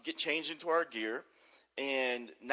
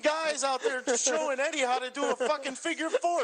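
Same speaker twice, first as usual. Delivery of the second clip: mocking impression; 12 semitones higher, 4 dB louder than usual. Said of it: "Perry Saturn comes walking by and he goes".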